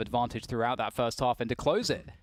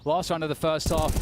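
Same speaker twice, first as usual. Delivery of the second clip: normal talking voice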